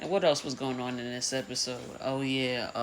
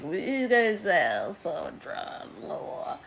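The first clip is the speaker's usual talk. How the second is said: higher, mocking dragon, dragging the last words slowly; 5 semitones higher, slower than usual. Dragon Caraxes mocking Rhaena's dramatic entrance, slow high voice. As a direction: drawl it out like an unimpressed beast.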